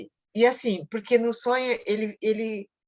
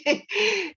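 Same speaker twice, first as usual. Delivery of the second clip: short laugh; 10 semitones higher than usual. Real, not acted. Laughs at her own prayer.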